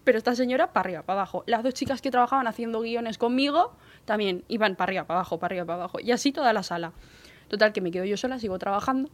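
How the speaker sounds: ordinary talk, nothing marked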